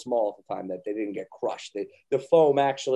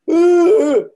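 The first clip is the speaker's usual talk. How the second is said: much higher, loud pained cry; pained kid groan; 14 dB louder than usual. Retold or imitated. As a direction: yelp like a hurt child. Daughter's hand caught in trunk.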